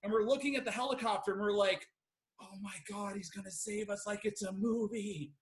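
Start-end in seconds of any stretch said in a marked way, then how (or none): 2.4-5.3 soft, hushed acted wonder; hushed awe. Stranded hiker marvels at the arriving helicopter, hushed awe. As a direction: hush it like a hopeful movie moment.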